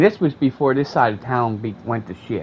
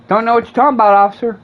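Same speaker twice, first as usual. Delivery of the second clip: much higher, louder fake innocence; mock innocence; 10 semitones higher, 7 dB louder, faster than usual. Teens to the officer, a mock-innocent denial. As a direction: play dumb for the cop.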